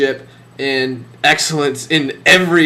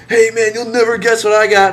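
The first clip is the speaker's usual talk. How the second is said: higher, eager mock of an excited friend; 7 semitones higher, faster than usual. Bandmate bragging about his new purchase, mock excitement. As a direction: gush it out, much higher. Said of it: "he's all excited".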